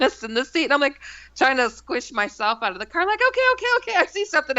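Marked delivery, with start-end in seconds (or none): 3.3-4.6 very high retold alarm; mock panic. Narrator recoils from shape in the car, panicky. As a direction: squeak out a panicky surrender.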